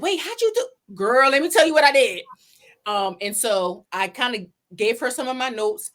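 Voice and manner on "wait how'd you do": surprised question. Retold or imitated. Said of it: "she said".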